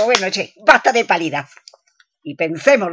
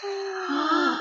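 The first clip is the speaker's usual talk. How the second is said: shocked high gasp; 10 semitones higher, 11 dB quieter than usual. Acted. Shocked by confession.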